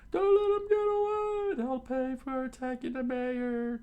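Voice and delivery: very high, strained falsetto shout; angry townsman. A narrator's reading. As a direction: rage after fugitives in a put-on squeal. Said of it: "scowled at them".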